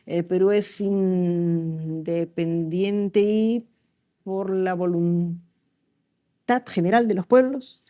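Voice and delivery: slow, halting, syllables split in the wrong places; dyslexic reading. Shown as a demonstration. Teacher showing students how a dyslexic child reads, slow and broken. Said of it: "se dan cuenta que separo las sílabas mal".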